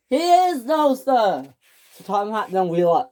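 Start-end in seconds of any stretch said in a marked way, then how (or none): 0.1-1.5 high insisting. Acted. Engine insists to the boss.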